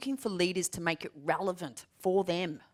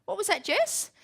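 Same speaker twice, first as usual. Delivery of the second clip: startled question; 13 semitones higher, 4 dB louder than usual. Retold or imitated. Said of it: "It's like".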